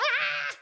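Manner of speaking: short, high, loud mock shriek; a burst of a high mock scream; imitated shriek. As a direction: let out a hammed-up squeal. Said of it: "And then she's like".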